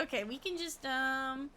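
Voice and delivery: ordinary delivery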